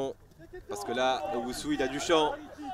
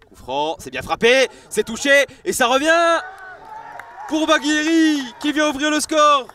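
shouting the goal, high and loud; excited sports commentary; 11 semitones higher, 12 dB louder than usual. Real, not acted. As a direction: roar it out as the goal goes in.

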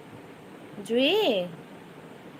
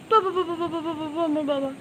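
rapid, high repeated plea, easing from loud; frantic pleading; 5 semitones higher, 4 dB louder than usual. Real, not acted. Owner begging Jui back from the edge, frantic pleading. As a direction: plead urgently, words tumbling over each other.

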